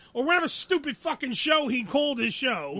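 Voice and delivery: ordinary delivery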